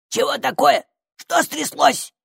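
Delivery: high, startled creature bark; barked question. A narrator's reading. Hidden stranger reacts to girl's cry, barked questions. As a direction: snap out the questions gruffly. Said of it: "рявкнул незнакомец".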